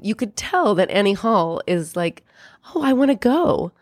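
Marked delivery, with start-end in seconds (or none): 2.7-3.7 eager, pleading. Retold or imitated. Annie eager to go.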